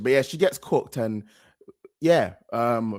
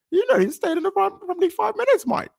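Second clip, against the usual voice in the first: much higher mocking put-on voice, high and sing-song; mimicking Flex. Host parrots Flex teasing Abby, high mocking voice. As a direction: ham up Flex's teasing "five minutes, mate". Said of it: "When I watch Flex, bro, and he does his impressions".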